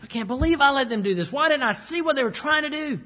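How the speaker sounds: a king berating himself aloud; self-reproach. Retold or imitated. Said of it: "He's mad at himself".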